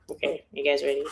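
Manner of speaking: ordinary talk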